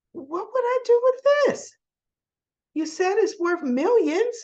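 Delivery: high-pitched mock disbelief; incredulous protest. Soap heroine handed the necklace back, mock incredulous. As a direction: imitate her balking, voice way up. Acting her incredulous reply.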